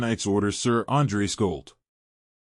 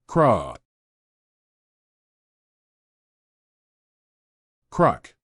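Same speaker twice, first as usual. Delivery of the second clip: rough, throaty beast growls; 2 semitones lower than usual. Acted. Possessed man growls while his soul is being devoured. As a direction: growl twice, a beast straining at its chain.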